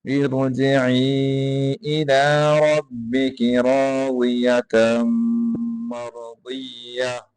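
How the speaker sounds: slow melodic tajweed chanting; Quran recitation. Acted. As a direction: draw each verse out in recitation.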